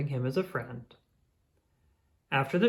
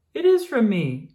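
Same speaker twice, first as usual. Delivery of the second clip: higher and louder, beaming; joyful reveal; 7 semitones higher, 8 dB louder than usual. Acted. Grampier revealing he left the card, beaming and loud. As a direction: announce it proudly.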